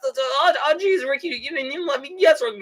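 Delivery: high, stammering cartoon kid; Morty. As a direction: whine a flustered protest in a high voice. Plays Morty complaining to Rick.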